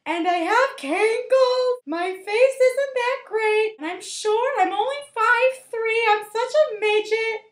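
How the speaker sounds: high, loud, drawn-out mock whine; hammed-up self-loathing lament; wailing heroine. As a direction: wail each flaw like a self-pitying teen.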